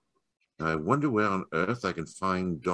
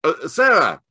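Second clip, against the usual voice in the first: louder, higher call across the garden; 10 semitones higher, 7 dB louder, slower than usual. Acted. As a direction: hail the girl by the gate. Holmes calls girl at distance.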